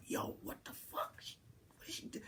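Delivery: scared whisper; hushed and urgent, hiding from her. Retold or imitated.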